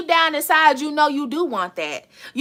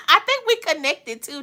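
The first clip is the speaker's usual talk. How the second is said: laughing through the words; giggle, then talk through it; 4 semitones higher than usual. Real, not acted. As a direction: laugh while saying it. Laughing at the card's message.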